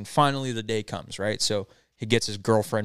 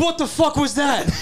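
very high, loud, drawn-out shriek; startled scream; 6 dB louder than usual. Retold or imitated. Actor jolted by the cannon going off, startled scream. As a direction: shriek it in shock, slow and high.

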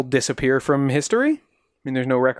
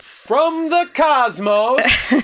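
dramatic; 11 semitones higher, much slower than usual. Pretend. Dramatic joke about cosmonauts.

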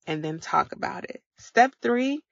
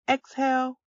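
put-on higher, sing-song cue to the kids; breathing cue to kids; 6 semitones higher than usual. Mom cueing her kids to inhale, sing-song. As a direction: call out the breath like a game.

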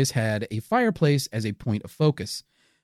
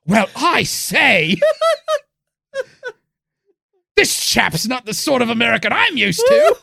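put-on posh British accent; 8 semitones higher, 3 dB louder than usual. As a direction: play a stuffy British gentleman. Posh English gentleman impression.

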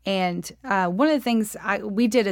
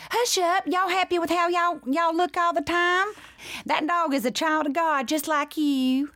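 high Southern mama scolding; 8 semitones higher than usual. Shown as a demonstration. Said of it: "How did my mama talk?".

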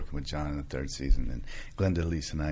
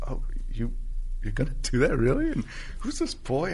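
playful teasing; 7 semitones higher than usual. Retold or imitated. Retold happy, teasing farewell.

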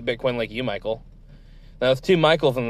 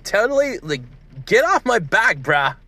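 put-on valley girl; 7 semitones higher than usual. Crypto guy snapping at the interviewer, high valley girl whine. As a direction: squeal it like an annoyed valley girl. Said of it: "I'm, like, a valley girl who loves Bitcoin".

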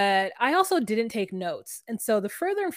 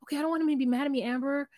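timid Puppy; 4 semitones higher, 3 dB quieter, faster than usual. Retold or imitated. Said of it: "Puppy's like".

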